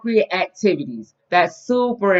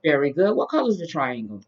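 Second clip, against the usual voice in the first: put-on reading to a toddler; 3 semitones lower, 6 dB quieter, slower than usual. Teaching toddler shapes.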